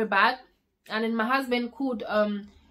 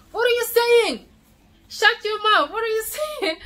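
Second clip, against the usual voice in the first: high, louder shocked rebuke; shocked scolding; 10 semitones higher, 4 dB louder than usual. Retold or imitated. Husband hearing she might die, shocked and scolding. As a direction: cut her off in disbelief. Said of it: "And he was like".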